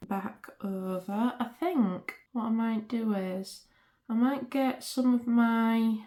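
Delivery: ordinary delivery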